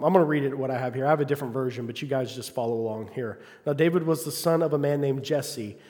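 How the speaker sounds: ordinary talk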